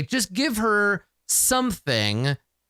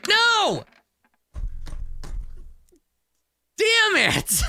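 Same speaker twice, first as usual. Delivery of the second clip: dismayed cry; much slower than usual. Real, not acted. Player crying out at a failed roll, dismayed.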